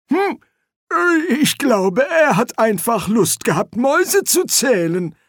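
put-on high old man's voice; excitable old wizard. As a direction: explain eagerly in a high-pitched codger voice. Figure: old wizard explaining.

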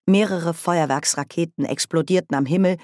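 plain reading voice

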